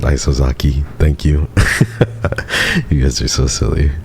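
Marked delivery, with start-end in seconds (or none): 1.5-2.8 breathy high-pitched giggle. Real, not acted. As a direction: let out a squeaky little laugh. Laughing at chat's silly messages.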